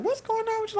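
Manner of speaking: very high mock friend voice; hammed-up high-pitched questioning; imitated concerned friend. Acting out friends asking.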